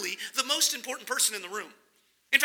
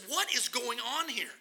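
ordinary talk, nothing marked

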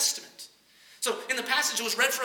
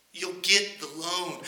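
calm insistence; 4 semitones lower, slower than usual. Acted. President calmly insists.

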